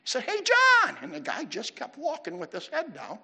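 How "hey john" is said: very high, full-voiced holler; imitated louder yell. Pastor calling a man who didn't respond, yelling louder. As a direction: holler harder to be heard. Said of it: "he yelled at him louder".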